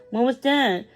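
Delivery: ordinary delivery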